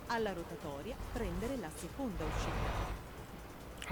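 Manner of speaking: high, soft, slow Italian sat-nav voice; imitating sat nav. Driver mimicking the Italian navigation voice, soft and slow. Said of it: "she says there".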